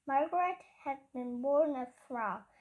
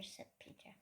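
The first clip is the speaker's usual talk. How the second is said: whisper; 20 dB quieter, faster than usual. A narrator's reading. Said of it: "unvoiced whisper".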